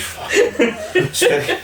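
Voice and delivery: high, slow words squeezed out through laughter; laughing so hard the voice goes high. Real, not acted. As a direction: crack up while saying it.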